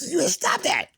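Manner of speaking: very high-pitched mock warning; voice gone up. Retelling his plea for Alan to stop, voice gone up. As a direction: squeal a panicked order. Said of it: "My voice went up".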